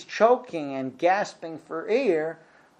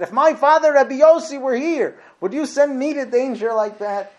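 raised high and loud, pleading in disbelief; indignant protest; 10 semitones higher, 9 dB louder than usual. Acted. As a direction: protest loudly as if insulted. Student protests being sent to danger.

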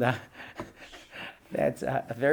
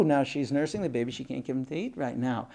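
ordinary talk, nothing marked

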